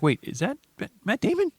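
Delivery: sudden high-pitched disbelief; surprised double take. Retold or imitated. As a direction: squeak out the name in shock. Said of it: "you're like".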